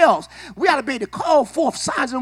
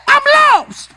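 high, loud helpless wail; a hammed-up cry of the lost; mock lost soul; 12 semitones higher, 8 dB louder than usual. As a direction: play the lost believer.

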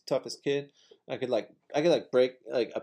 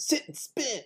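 much higher, sing-song sass; campy taunt; 11 semitones higher, 3 dB quieter, slower than usual. Shown as a demonstration. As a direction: toss it off with camp flair.

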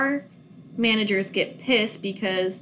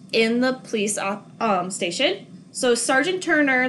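usual speaking voice

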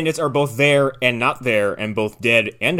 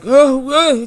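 babbling madman; 12 semitones higher, 6 dB louder than usual. Retold or imitated. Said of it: "just babbling to themselves".

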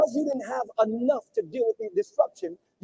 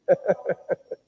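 short laugh; 6 semitones lower than usual. Real, not acted. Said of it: "I'm laughing because".

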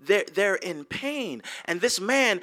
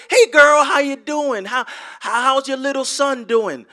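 cheerful greeting; 4 semitones higher, 8 dB louder than usual. Retold or imitated. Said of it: "you say".

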